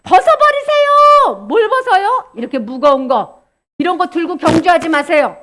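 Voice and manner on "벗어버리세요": high, loud, drawn-out exhortation; urging command. Acted. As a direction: call it out slowly and forcefully. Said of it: "성령님이".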